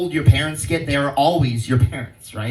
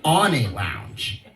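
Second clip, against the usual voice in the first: rhyme recital; 5 semitones higher than usual. Pretend. Rhyming riff on the word lounge.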